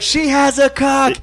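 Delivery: slow, high blurted call; retelling the heckle, drawn out and high; mock shout. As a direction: call it out slowly. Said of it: "and I'm like".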